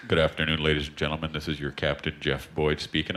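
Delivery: a hint of airline captain calm; pilot announcement. Captain greeting passengers over the intercom, lightly voiced. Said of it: "The captain's like".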